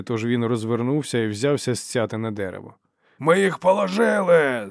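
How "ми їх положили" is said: high, loud, drawn-out cheer; triumphant bellow. A narrator's reading. Tul slaps his comrade's back, bellowing. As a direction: shout the victory out slowly. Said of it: "заволав Тул".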